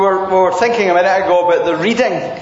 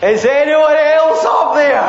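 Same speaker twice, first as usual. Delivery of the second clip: desperate call; 6 semitones higher than usual. Acted. Man's desperate punchline.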